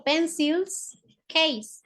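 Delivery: PENCILS and CASE slowly, with a gap between; slow repetition. Shown as a demonstration. Teacher repeating the English term for pupils, slow repetition. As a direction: separate "Pencils" from "case", drawing each out.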